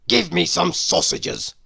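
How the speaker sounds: loud, curt snap; snapping. A narrator's reading. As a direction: bite the words off like a grouch. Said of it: "snapped Mister Grumpy".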